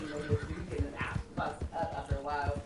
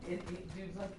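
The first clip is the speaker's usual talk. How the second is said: ordinary delivery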